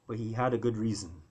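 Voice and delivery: plain reading voice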